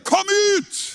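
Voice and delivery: high ringing shout; preacher's cry. Real, not acted. Preacher calling out to the congregation's darkness, high shout. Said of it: "så roper vi bare".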